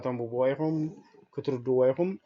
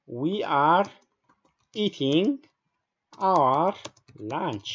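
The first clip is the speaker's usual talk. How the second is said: word by word, with long gaps; slow dictation; 2 semitones higher, much slower than usual. Shown as a demonstration. Teacher dictating an example sentence to the class, slow and segmented. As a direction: spell out the sentence at writing pace.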